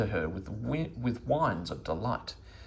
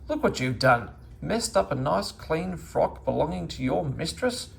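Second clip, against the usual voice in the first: a mild scolding, a bit higher; 7 semitones higher, 3 dB louder than usual. A narrator's reading. Meddle scolding the dog over the muddy dress, mildly cross. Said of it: "he scolded the dog".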